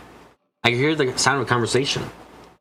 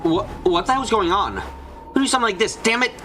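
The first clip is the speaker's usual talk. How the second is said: shocked and angry; 10 semitones higher than usual. Acted. Trapped behind a stake-chained door.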